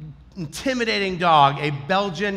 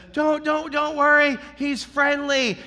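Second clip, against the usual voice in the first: high-pitched imitation of the owner; reassuring dog owner; 8 semitones higher than usual. Dog owner reassuring the threatened walker, high-pitched. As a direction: placate in a raised, put-on voice.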